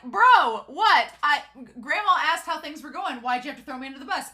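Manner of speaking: indignant disbelief. Retold or imitated. Grandson complaining to his colleague, indignant. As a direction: act out baffled outrage. Retold protest at being betrayed.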